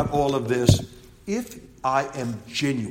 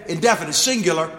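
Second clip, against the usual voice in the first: ordinary talk, nothing marked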